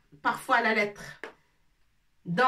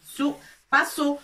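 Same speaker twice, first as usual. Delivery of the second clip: "sou" pushed louder and higher against "so"; sou not so; 4 semitones higher, 4 dB louder than usual. Shown as a demonstration. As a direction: contrast "sou" with "so", hitting "sou". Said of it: "ça se prononce sou". ("sou" appuyé, plus haut et plus fort, contre "so")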